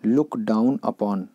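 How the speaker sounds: very slow, word by word; drawn out for the class; slow teacher dictation. Shown as a demonstration. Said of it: "teacher repeats idiom slowly".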